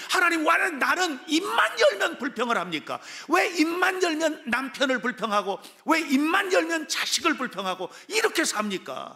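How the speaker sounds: high-pitched, exasperated cry to God; pleading lament. Acted. Complainer praying to God about grumbling, exasperated plea. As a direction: plead upward in an acted prayer of self-reproach. Acted prayer of habitual complainer.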